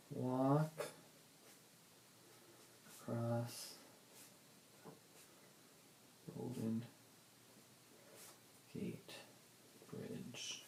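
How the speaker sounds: soft, word by word with long pauses; absently dictating to yourself, very quiet; saying while writing. Real, not acted. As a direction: murmur each word slowly as you write it. Writing the words down.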